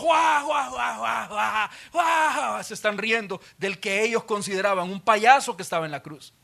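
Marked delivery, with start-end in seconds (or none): 0.0-2.6 higher mocking shouts; jeering crowd. Crowd howling at the man on the cross, jeering shout. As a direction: howl like a jeering mob.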